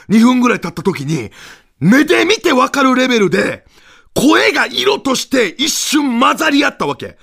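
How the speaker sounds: ordinary talk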